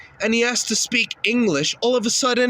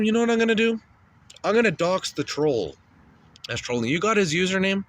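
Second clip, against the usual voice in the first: ordinary delivery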